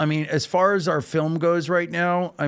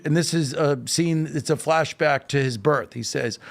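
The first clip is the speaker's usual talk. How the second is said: usual speaking voice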